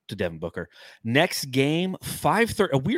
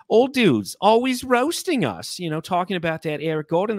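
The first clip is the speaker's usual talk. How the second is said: put-on New York accent, higher voice; 3 semitones higher than usual. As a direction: rant like a New Yorker. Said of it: "so I'll go back to my".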